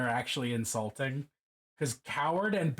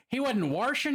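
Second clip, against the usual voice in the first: Baltimore accent, washing as warshing, a bit louder; 11 semitones higher, 4 dB louder than usual. Shown as a demonstration. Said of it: "That is not a particularly Balmer accent".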